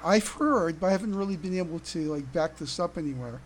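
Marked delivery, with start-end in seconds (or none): none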